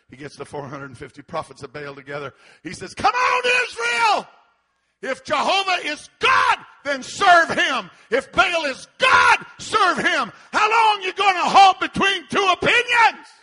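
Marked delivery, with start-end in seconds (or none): 2.9-13.3 high, slow, full-force preacher shout; shouted challenge. Acted. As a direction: challenge a whole nation at the top of your voice. Acts Elijah challenging all Israel.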